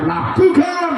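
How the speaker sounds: sharp high shout; shouted call. Real, not acted. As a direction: call the kick like a commentator. Calling the penalty kick.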